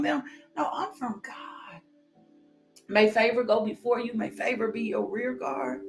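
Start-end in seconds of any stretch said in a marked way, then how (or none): none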